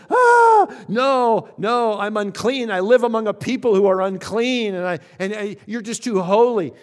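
loud, high dramatized cry of unworthiness. Acted. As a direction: wail in mock dread, recoiling from holiness. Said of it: "he cried out".

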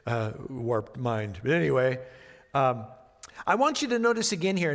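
ordinary talk, nothing marked

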